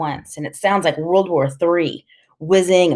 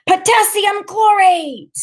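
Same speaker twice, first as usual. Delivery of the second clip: piercingly high, loud, drawn-out cry; excited kid shouting to the sky; jubilant shout; 5 dB louder, slower than usual. A narrator's reading. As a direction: call it out slowly at the top of your range. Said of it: "he shouts".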